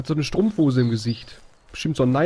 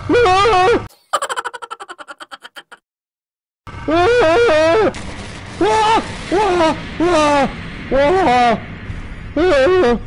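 high, loud shrieks of fright; terrified screams; 6 dB louder, much slower than usual. Real, not acted. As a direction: scream in real terror. Being caught.

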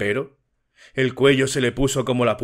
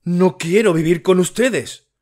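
louder, higher anguished cry; tearful shout; 6 semitones higher, 5 dB louder than usual. A narrator's reading. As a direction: break down into a furious cry. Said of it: "llorando y mirando a la madre con cólera".

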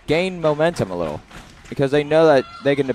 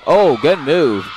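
drawn out, louder exclamation; excited reaction; 3 semitones higher, 6 dB louder, slower than usual. Real, not acted. Commentator reacting to a good move, excited. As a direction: praise a good play live, slow and loud.

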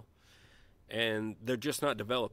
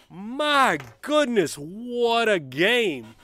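savoring; 8 semitones higher, 8 dB louder, much slower than usual. Real, not acted. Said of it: "savoring the win".

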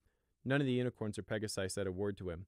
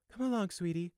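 a hint of a strained, higher mother's voice; 10 semitones higher than usual. Mother coaxing her son away: strained. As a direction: keep it sweet but tight. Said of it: "she said in a strained voice".

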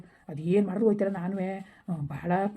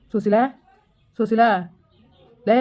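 calling out; 2 semitones higher, 5 dB louder, slower than usual. Acted. Man calls to crying partner.

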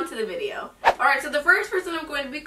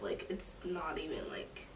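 whisper; 6 semitones lower, 14 dB quieter than usual. Acted. Secret detail on prank call.

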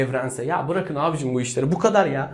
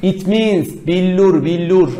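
slow, loud, higher-pitched announcement; loud punchline; 4 semitones higher, 8 dB louder, slower than usual. Pretend. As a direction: belt out the punchline, drawn out. Shouted punchline before laughing.

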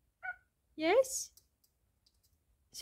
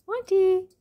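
calling out; 6 semitones higher, 7 dB louder than usual. Real, not acted. Calling the dog.

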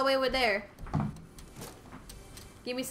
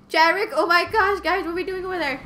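calling out; 7 semitones higher, 10 dB louder than usual. Real, not acted. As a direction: shout across the map at a lost teammate. Calling out to wandering teammate.

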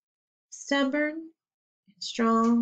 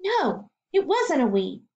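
usual speaking voice

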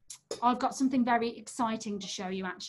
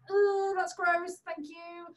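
ordinary delivery

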